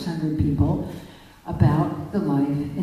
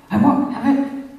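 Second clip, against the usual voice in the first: I won't have it pushed higher and louder, the word have hit hard; raised refusal; 7 semitones higher, 4 dB louder than usual. Retold or imitated. Angry man rejecting her love, loud defiant refusal. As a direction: bark the refusal back at her.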